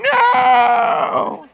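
put-on high shouted child's No; crying child. Child left behind in the store, shouting No. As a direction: wail it like a panicking kid. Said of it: "And then they start crying".

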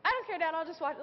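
put-on high, carefree kid voice brushing it off; high mimicked child shrugging off a scolding; sassy little girl. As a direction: play the unbothered four-year-old talking back to Dad. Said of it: "I mean".

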